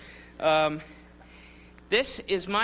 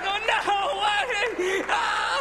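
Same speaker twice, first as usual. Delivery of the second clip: high-pitched panicked wail into a scream; mock horror; 13 semitones higher, 3 dB louder than usual. Comedian imitates the crowd hit by urine, mock horror. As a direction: wail in hammed-up disgusted panic. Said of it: "and they're like".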